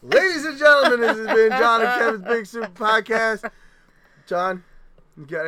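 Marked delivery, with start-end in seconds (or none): 0.0-3.5 loud, high announcer sign-off. Real, not acted. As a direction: boom it like a host.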